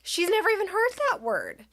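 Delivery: high, quick defensive protest; appalled protest. Retold or imitated. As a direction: protest it in high-pitched disbelief. Mother protests to staring strangers.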